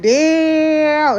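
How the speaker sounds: high, stretched-out mock amazement; amazed damn. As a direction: let the damn rise high and hang in disbelief. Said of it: "And I was like".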